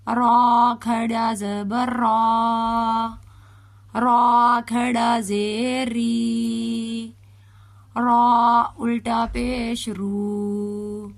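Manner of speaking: put-on slow, drawn-out syllable spelling for a child to repeat; slow spelling drill. Shown as a demonstration. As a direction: spell each vowel mark out slowly. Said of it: "تو اسے ہم باریک پڑھیں گے". (آہستہ آہستہ ہجے کرنا)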